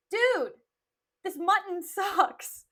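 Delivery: loud, high mock outburst; hammed-up bellow at the servants; mock tantrum. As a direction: imitate an exasperated master, drawn out and loud. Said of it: "turns to his servants and is just like".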